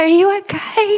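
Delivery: high-pitched, tentative question to an injured bird; high anxious check-in; timid concern. Retold or imitated. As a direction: ask it high, as if to something hurt.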